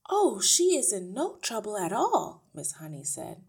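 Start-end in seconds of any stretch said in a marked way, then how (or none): 0.1-2.4 lightly voiced teacher, higher and louder; a hint of eager reassurance, pitched up; reassuring teacher. A narrator's reading. Miss Honey corrects the father at the door, reassuring.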